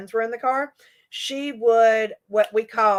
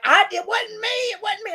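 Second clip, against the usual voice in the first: high-pitched mock protest; protesting kid; 10 semitones higher than usual. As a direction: imitate a kid frantically denying it. Embarrassed kid protesting innocence.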